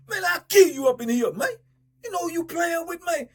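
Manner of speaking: loud, high shouted threat; imitating an inmate's threat; furious threat. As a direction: threaten him at full volume. Rip threatening celly.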